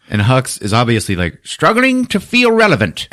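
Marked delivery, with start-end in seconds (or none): 1.4-3.1 high, straining comic voice; mock his desperate need to matter; mocking strained delivery. Mocking Hux's need for attention.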